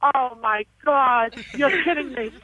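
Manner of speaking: slow, low, deflated disbelief; stunned disbelief. Real, not acted. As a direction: let it sink in slowly, voice dropping. Mother learns it was a prank.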